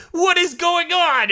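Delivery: very high, slow, stunned whine; mock bewildered. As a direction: stretch it out in disbelief. Beers taking damage.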